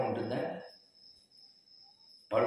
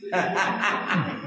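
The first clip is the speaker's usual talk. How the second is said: laugh; 3 semitones higher than usual. Real, not acted. Laughs at own story.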